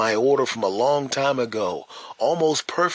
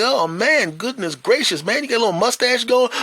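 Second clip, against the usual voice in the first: high, loud surprise; amazed greeting; 12 semitones higher, 4 dB louder than usual. Retold or imitated. Man marvelling at the grown boy's height, high and amazed. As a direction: marvel at how he's grown. Teasing the teen.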